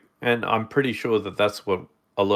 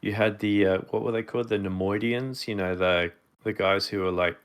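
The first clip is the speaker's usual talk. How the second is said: ordinary talk, nothing marked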